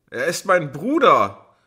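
screamed high and loud; desperate scream. A narrator's reading. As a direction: scream it at her, wild-eyed. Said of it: "schrie sie". (verzweifelter Schrei)

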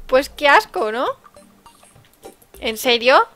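ordinary talk, nothing marked

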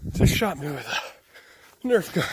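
ordinary delivery

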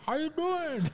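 high, squeaky mock Scarface voice; bad Scarface impression. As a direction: do a terrible Scarface at the drive-thru. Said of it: "the worst Scarface impression ever".

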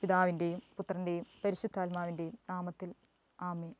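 soft, low sign of the cross; murmured opening blessing; prayer. Acted. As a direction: begin the prayer hushed and reverent.

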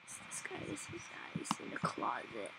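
low unvoiced whisper so the killer won't hear. Real, not acted. Hiding from the killer in a game, a low secretive whisper. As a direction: breathe the words out, barely there.

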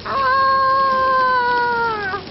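long high loud scream. A narrator's reading. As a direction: shriek as the water takes them. Swept down the stream.

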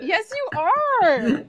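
high, drawn-out protest with laughter; laughing, high-pitched contradiction; insistent reassurance. Real, not acted. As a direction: stretch it out, insisting playfully. Insisting friend is good.

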